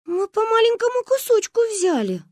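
put-on high small girls; high little girl voice. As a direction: plead like a child. Girls excuse themselves.

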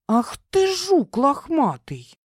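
hissed in mock offence, high and slow; playfully outraged, dragging the words; theatrical offended hiss. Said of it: "с театральной обидой прошипела Одри". (театрально обиженное шипение)

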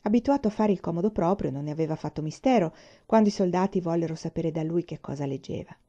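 ordinary delivery, nothing marked

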